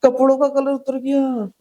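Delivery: hammed-up grief over faded clothes; mock woman lamenting. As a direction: wail like a friend just died. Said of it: "वो filler ऐसे दे रही हैं".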